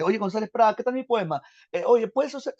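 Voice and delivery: put-on high eager pleading voice of young Vallejo; Vallejo voiced. Vallejo habla a Prada muerto. (voz alta y ansiosa de Vallejo suplicando)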